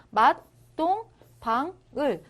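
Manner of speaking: 맛동방을 slow, broken into syllables; syllable-by-syllable reading. Shown as a demonstration. Teacher spells out the reading, 맛동방을 slowly separated. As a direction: break 맛동방을 up with a gap after each syllable. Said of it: "이런 식으로 그냥 읽으시면".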